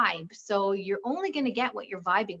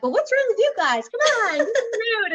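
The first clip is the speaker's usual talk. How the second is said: high, loud hammed-up pep; rally the gloomy room, over the top; mock cheerful urging; 12 semitones higher, 6 dB louder than usual. Happy at home, she urges others into her mood, loud and high. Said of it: "and you're like".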